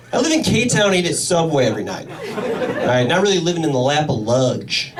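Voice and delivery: usual speaking voice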